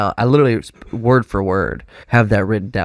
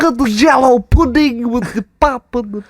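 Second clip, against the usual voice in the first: Cosby voice; 5 dB louder than usual. Retold or imitated. Cosby pudding bit.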